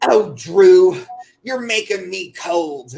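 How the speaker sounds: put-on high, plaintive old-lady complaint; mock wail of a chilly old woman; imitating old lady friend. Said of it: "she'd come out and she'd go".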